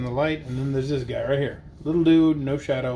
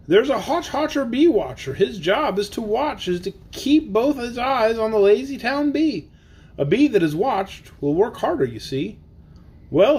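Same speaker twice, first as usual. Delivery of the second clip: lively, sing-song storybook reading; bouncy picture-book narration; reading to children; 6 semitones higher than usual. Acted. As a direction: read the rhyme aloud for the kids. Reads Seuss aloud to kids.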